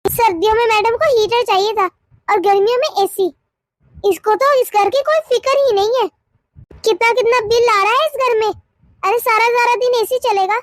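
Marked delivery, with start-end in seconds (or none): none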